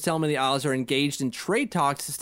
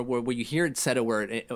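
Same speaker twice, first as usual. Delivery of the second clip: usual speaking voice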